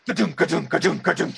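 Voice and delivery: rhythmic spoken ka-dum beat; imitated thudding noise. Player imitating a character in action, rhythmic thudding noise. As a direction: mimic repeated heavy thuds with the voice.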